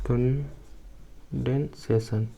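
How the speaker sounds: Condensation drawn out slowly and softly; slow dictation. Shown as a demonstration. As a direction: stretch condensation for note-taking. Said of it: "बोलते हैं हम लोग condensation".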